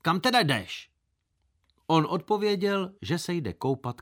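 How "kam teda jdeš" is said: sharp demand. A narrator's reading. Narrator to the unwanted tag-along, sharp questioning. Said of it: "jsem se ho prudce otázal".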